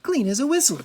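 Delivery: high, loud pleased announcement. A narrator's reading. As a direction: declare it done like a proud princess. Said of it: "she said".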